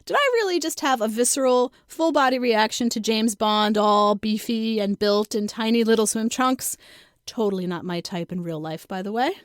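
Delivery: ordinary delivery, nothing marked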